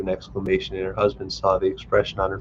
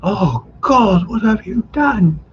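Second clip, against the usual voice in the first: high, louder, crying alarmed mother; 12 semitones higher, 6 dB louder, much slower than usual. A narrator's reading. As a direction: wail at the fall. Mother sees daughter fall.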